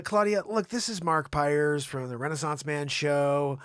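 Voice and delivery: ordinary delivery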